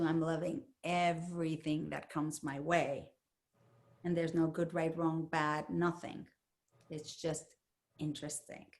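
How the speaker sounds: soft calm heart voice, trailing off quietly; loving heart. Retold or imitated. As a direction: voice the heart as gentle and accepting, fading out. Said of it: "the heart goes into love mode".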